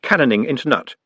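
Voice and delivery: plain reading voice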